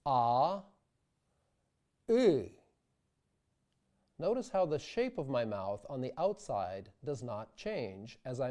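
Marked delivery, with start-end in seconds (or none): 0.1-2.5 vowel demo ah then E. Shown as a demonstration. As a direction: keep the mouth open and move the tongue. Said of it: "like this".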